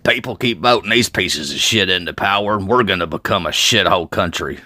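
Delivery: ordinary delivery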